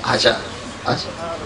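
tender call. Acted. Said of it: "जैसे माँ बाहें फैला के खड़ी हो".

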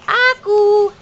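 put-on high sing-song word for baby; cooing first-word prompt; high coaxing prompt. Shown as a demonstration.

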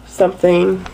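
usual speaking voice

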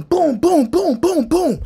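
high rhythmic run of booms; punch sound effects. Retold or imitated. Storyteller acting out beating the guy, punch sound effects. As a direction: bounce out each blow evenly.

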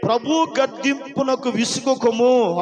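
usual speaking voice

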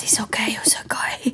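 put-on soft, hushed reassurance in Spanish accent; imitated Spanish masseuse. Spanish masseuse after kicking the stand, imitated soothing whisper. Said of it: "like, this beautiful accent".

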